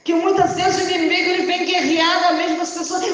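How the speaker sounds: high, loud fiery preaching with shouts; impassioned shouted sermon building to a roar; preaching at full force. Acted. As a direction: thunder it out over the congregation like a revival preacher. Preaching climax to the church.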